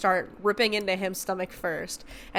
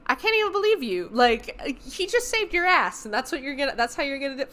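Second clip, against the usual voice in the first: indignant outrage; 6 semitones higher than usual. Retold or imitated. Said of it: "And I'm like".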